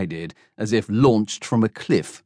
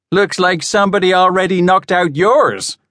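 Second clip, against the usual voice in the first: high, loud mocking jab; cheeky heckler; 9 semitones higher, 4 dB louder than usual. A narrator's reading. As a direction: fire back a cheeky insult.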